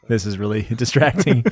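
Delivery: ordinary talk, nothing marked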